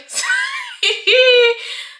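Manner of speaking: loud high laughter; high shrieking laugh. Real, not acted. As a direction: keep laughing hard. Laughter continues.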